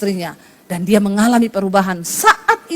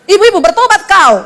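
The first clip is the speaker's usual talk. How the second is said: raised, higher scolding call to wives; stern rebuke; 8 semitones higher, 5 dB louder than usual. Acted. Preacher now rebukes wives too, loud and high. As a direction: thunder a warning at the wives. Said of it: "Berarti sekarang saya tambah".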